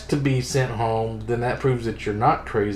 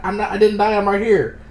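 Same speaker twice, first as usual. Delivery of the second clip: higher-pitched mock protest; indignant protest; 7 semitones higher, 4 dB louder than usual. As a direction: protest in disbelief at being written off. Acted protest of man declared dead.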